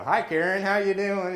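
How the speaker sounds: high, loud sing-out hello; cheery greeting. Retold or imitated. Pastor greets a church lady, cheery and high. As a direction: greet them brightly at high pitch.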